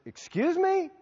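high, rising disbelief; acted-out taken-aback query; incredulous. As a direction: question it as if you misheard. His shocked reply to refusal.

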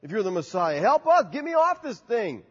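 retold taunt of the criminal on the cross; mocking criminal. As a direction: jeer at Jesus from the cross. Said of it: "he's like".

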